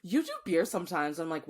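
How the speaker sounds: normal talking voice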